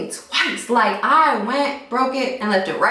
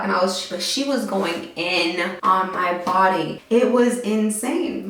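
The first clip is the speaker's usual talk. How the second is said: ordinary talk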